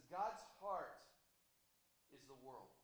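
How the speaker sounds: solemn poem read off mic, far away. Real, not acted. Preacher closing the sermon with his own poem, solemn and far off mic. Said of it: "just close by reading a, a poem".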